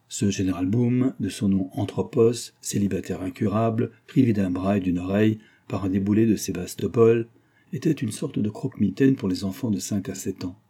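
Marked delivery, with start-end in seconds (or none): none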